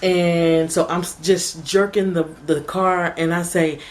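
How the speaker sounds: ordinary talk, nothing marked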